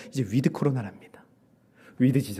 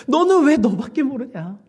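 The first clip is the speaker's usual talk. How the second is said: high, loud, slow rebuke; reproachful pressing; 9 semitones higher, 6 dB louder than usual. Retold or imitated. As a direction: drive the accusing question home. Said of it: "다그치시는 거예요". (높고 크게 힘주어 다그치듯)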